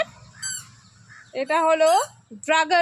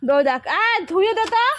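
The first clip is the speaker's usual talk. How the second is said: calling out; 6 semitones higher, 5 dB louder, faster than usual. Real, not acted. Calling to mother for help.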